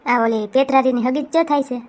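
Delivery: ordinary talk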